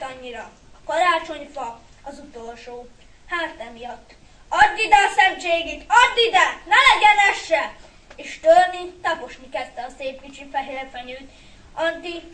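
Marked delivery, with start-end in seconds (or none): 4.5-7.7 loud, high outburst; furious boy shouting; angry demand. A narrator's reading. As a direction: lash out in desperate rage. Boy grabs tree to smash it.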